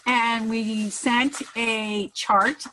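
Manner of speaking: normal talking voice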